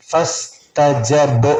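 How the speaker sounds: slow "ഫസ്തജബ്" with an echoing final b; bounced b. Shown as a demonstration. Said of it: "ബ് എന്നുള്ള സുക്കൂനാണ് back".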